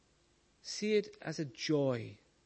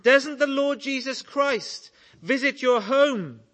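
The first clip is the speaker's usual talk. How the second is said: a higher, louder shout into a deaf ear; loud, raised shout to the deaf old lady; shouted question; 8 semitones higher, 7 dB louder than usual. Acted. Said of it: "he shouted in her ear".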